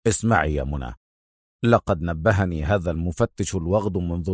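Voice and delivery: low, soft, firm explanation. A narrator's reading. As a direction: cut in quietly and firmly. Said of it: "قاطعها في حزم".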